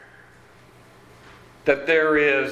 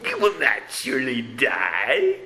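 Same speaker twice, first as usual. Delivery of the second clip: hammed-up sinister villain, laughing; 3 semitones lower than usual. Shown as a demonstration. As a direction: play the serpent as a mock-sinister villain. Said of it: "do it in a sinister voice".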